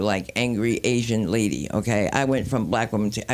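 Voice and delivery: ordinary talk, nothing marked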